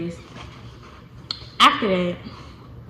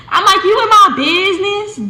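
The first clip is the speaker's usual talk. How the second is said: sassy retort; 11 semitones higher, 9 dB louder than usual. Retold or imitated. Mock-defensive retort to friend.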